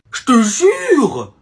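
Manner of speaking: slow, stretched "Je te jure!"; drawn-out plea. Acted. Boy begs his sister to believe he won the fight, stretched out. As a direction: drag the oath out to be believed.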